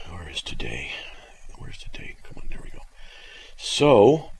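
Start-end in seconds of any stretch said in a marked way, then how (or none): 0.1-2.8 hushed, half-whispered self-talk; muttering to himself. Real, not acted. Host looking for today on his schedule, muttering under his breath. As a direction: murmur absently while searching.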